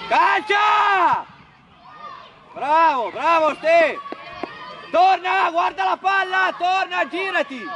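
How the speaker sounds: urgent sideline shouting; shouted coaching calls. Real, not acted. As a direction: shout it across the pitch. Coach calling to players mid-match.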